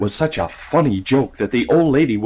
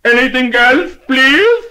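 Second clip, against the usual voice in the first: much higher, much louder shout; ear-splitting shout; 11 semitones higher, 6 dB louder, slower than usual. Acted. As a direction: bellow it so a deaf lady hears. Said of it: "lifted his voice to an ear-splitting shout".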